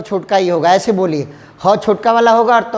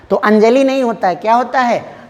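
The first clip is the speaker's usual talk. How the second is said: ordinary talk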